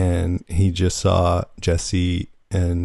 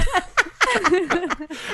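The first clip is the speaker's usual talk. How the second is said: high burst of laughter; big laugh. Real, not acted. Laughing at own joke.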